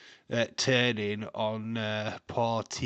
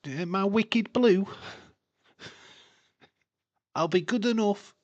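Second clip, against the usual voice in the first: put-on Liverpudlian accent; 11 semitones higher, 7 dB louder than usual. Spoofing the milk advert in a Liverpudlian accent. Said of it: "a Liverpudlian accent".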